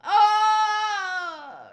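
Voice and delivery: high, loud long wail fading out; falling cry. Acted. Castaway falling into the sea, long high cry. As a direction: cry out long as if dropping away.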